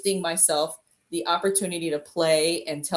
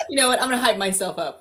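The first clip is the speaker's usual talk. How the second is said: usual speaking voice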